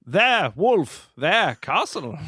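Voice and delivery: high, slow, hammed-up character lines; put-on film voice. Quoting the movie's werewolf gag in a high put-on voice. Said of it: "Why are you talking like that?".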